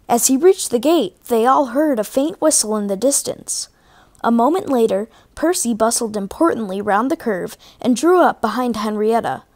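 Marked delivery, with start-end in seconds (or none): none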